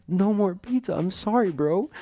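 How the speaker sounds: high, loud imitation of a weepy stoner; mock crying. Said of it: "He's literally crying".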